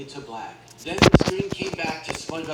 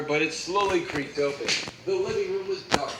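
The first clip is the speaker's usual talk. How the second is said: plain reading voice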